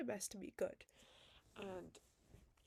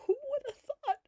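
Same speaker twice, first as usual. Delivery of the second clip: mock-amazed squeal, much higher and louder; sarcastic high squeak; 12 dB louder, much faster than usual. Real, not acted. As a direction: toss it off in sarcastic disbelief. Sarcastic aside about friendships.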